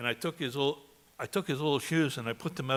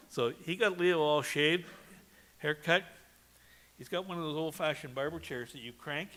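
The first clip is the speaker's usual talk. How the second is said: ordinary delivery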